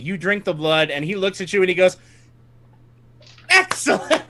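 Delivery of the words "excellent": much higher, gleeful villain cry of "Excellent"; mocking villain cry. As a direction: crow it like a delighted fiend. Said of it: "he goes roaring, laughing".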